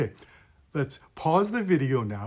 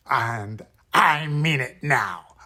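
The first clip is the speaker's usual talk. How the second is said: slow, louder, throaty menace; slow pirate threat; 6 dB louder, slower than usual. Acted. Pirate bad guy insisting he means it, slow gruff menace. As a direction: lean into each word like a threatening pirate. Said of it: "the voice of a bad guy".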